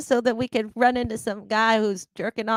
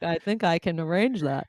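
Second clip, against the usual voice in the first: Santa voice; 7 semitones lower than usual. Retold or imitated. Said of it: "Santa's like".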